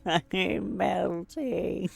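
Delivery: very slow, high drawn-out moan; melting dramatically. Acted. Detective sliding down the chair, slow high moan. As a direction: melt away like the witch.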